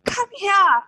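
retold scream. Wife screaming for her husband after a positive pregnancy test, high excited yell. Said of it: "screaming and I was like".

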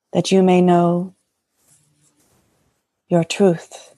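lightly voiced serene goddess speaking; a hint of a blessing divine mother; channeling Mary Magdalene. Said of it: "And the Magdalene would like to speak".

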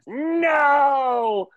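high, loud villain's cry; anguished no. Retold or imitated. Insect King recoils from the Ring of Power with a high, loud cry. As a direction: howl it in horror. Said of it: "the Insect King responds".

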